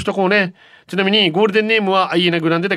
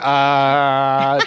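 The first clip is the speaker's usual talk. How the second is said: into a fan; 5 semitones lower than usual. Retold or imitated. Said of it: "扇風機に向かって".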